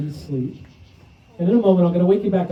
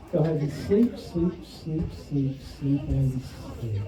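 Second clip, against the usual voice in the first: hypnotic sleep command; 3 semitones lower, 7 dB quieter, much slower than usual. Acted. Hypnotist putting subjects to sleep.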